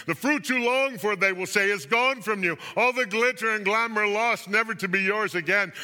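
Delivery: high, loud cry of loss; grand dramatic lament over lost riches. A narrator's reading. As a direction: mourn it out loud, pitched high. Mourners lamenting lost luxuries.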